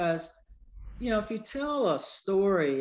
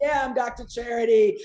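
stage introduction; 6 semitones higher, 8 dB louder than usual. Retold or imitated. Introducing herself on comedy stage.

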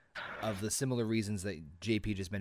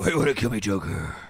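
loud hammed-up Batman growl; deep Batman voice; 10 dB louder, faster than usual. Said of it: "fucking does that deep voice".

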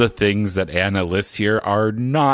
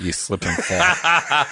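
slow Pittsburgh accent with a laugh; drawn-out, higher Pittsburgh accent, amused; 5 semitones higher, slower than usual. Shown as a demonstration. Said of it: "That's a good accent".

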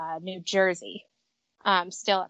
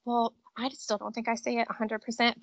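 normal talking voice

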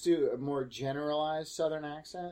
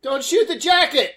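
shouting; 11 semitones higher, 12 dB louder than usual. Real, not acted. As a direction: yell it high and loud. Yelling at movie screen.

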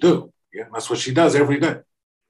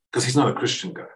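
normal talking voice

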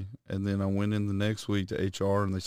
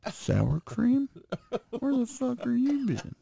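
mock shrill wife; slower than usual. Mock wife quote, high.

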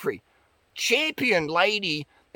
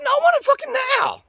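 much higher, fast, impatient mock Oprah; demanding Oprah; 4 dB louder, faster than usual. The rich client demanding quick results, high and impatient. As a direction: whine it like a spoiled rich client. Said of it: "She's like".

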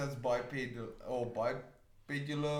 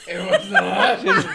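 hammed-up fake Russian accent, much higher and louder; mock Russian accent; 10 dB louder than usual. Friend mocks the Russian menu in a fake Russian accent. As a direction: shout it in a bad Russian accent. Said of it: "That's not how Russians sound".

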